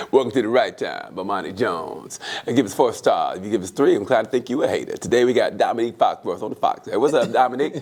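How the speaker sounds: low-pitched radio host impression; Bomani Jones. Host welcoming listeners to the show, low impression. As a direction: drop far down into a show-opening voice. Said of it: "Here's my Bomani Jones".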